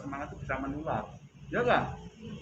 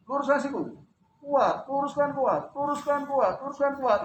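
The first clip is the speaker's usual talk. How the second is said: coaching command; 10 semitones higher, 8 dB louder than usual. Shown as a demonstration. Physio teacher pushing a patient to straighten the elbow, loud commands. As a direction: push them to straighten hard. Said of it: "coaching extension".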